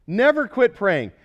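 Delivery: loud, driving sermon voice; fervent preaching. Acted. Pastor urging the congregation never to quit praying, loud and fervent. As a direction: press the congregation hard.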